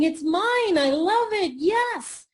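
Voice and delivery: high, loud, jubilant claim; high-energy exclaiming. Acted. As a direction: belt it out with rising excitement. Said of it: "if you're walking around saying".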